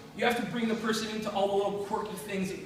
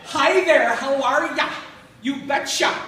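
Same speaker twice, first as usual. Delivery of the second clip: put-on higher, loud Fargo accent; exaggerated South Dakota accent, pitched up and loud; 5 semitones higher, 8 dB louder than usual. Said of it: "Like that movie Fargo".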